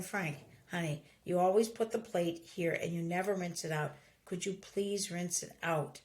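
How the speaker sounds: low, soft, controlled reproach; calm nagging tone. Acted. Wife telling husband to rinse his plate, softly insistent. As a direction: ask sweetly but make it an order. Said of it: "Instead of saying".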